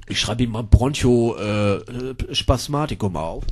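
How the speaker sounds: low, very soft doctor's mumble; murmuring while writing. Acted. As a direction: say it quietly to yourself, with hesitations. (leise vor sich hin gemurmelt)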